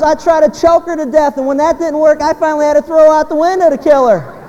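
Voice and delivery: high, loud punchline delivery; hammed-up shouted explanation; acted exasperated yell. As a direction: belt it out as a worked-up husband. Joke's punchline from exasperated husband.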